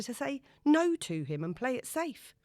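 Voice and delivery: ordinary talk, nothing marked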